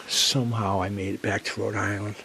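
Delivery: ordinary delivery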